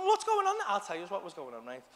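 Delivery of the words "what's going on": high-pitched anxious question; mock flustered partner voice; imitating worried partner. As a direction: ask it in a raised, put-on voice. Voicing partner asking.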